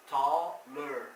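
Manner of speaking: "taller" in two loud pieces, "tall" and "ler"; deliberate, separated "taller" for learners; split syllables. Shown as a demonstration. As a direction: break "taller" before its ending. Teacher recaps word in parts.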